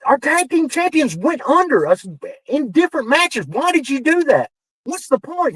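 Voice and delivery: high-pitched, incredulous questioning; retold exasperated protest. Writer challenging John over beaten champions, retold exasperation.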